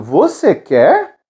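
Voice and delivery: VOCÊ QUER drawn out, rising at the end; question intonation. Shown as a demonstration. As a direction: ask "Você quer" slowly, lifting QUER. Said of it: "E aí perguntando". (entonação de pergunta, "Você quer" subindo no fim)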